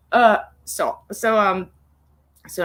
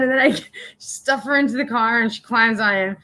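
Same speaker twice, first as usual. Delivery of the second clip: ordinary talk, nothing marked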